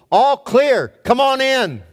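high, loud call through the door; yelling out. Retold or imitated. Ghost luring the swat team in, loud call. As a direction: sell the fake all-clear shout. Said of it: "he yells out to the team".

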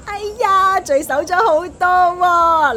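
put-on high, loud cooing over a baby; drawn-out fussing surprise as you lift the baby; gushing auntie exclamation; delighted exclamation. Neighbour lifts baby, exclaims at weight.

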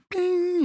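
sudden squeaky high ping like a struck glass. Retold or imitated. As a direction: chime a tiny ping way up high. Imitating glass ringing when struck.

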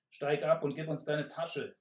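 loud growling order; growled demand. Acted. Stocky robber growls at the messenger to dismount. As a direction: growl the order through the beard. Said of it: "knurrte nun der Stämmige".

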